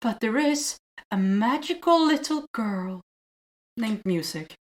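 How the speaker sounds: slow, dreamy reading of the will; lightly voiced whimsical narrator; sweet storybook voice. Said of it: "she says".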